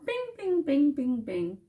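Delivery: high chimed bings, dropping down; imitated bell. Vlogger imitating the notification bell for viewers, high chiming bings. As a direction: ring out each bing like a notification bell. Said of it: "Hit the notification bell".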